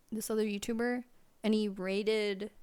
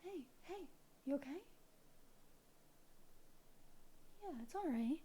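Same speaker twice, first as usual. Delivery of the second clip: high and much softer; soothing hush; 5 semitones higher, 13 dB quieter than usual. Real, not acted. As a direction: hush the cat tenderly. Calming cat.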